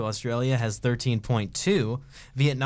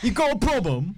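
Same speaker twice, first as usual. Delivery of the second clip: higher, put-on Birmingham accent; 6 semitones higher than usual. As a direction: challenge them in a mock Birmingham accent. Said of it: "like, Birmingham accent where it's".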